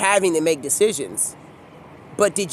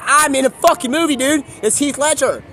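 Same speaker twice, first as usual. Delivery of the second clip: higher, louder mock protest; indignant; 6 semitones higher, 3 dB louder than usual. Actor objects to being called Keith, indignant. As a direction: correct him in exasperated outrage.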